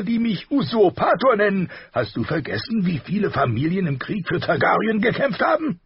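loud, high, blustering king voice; gruff king. A narrator's reading. Robert voiced in audiobook.

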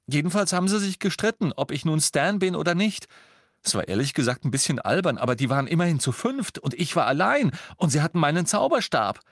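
high, quick storytelling; agitated account. A narrator's reading. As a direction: hurry on with the story. Ron continues his agitated story.

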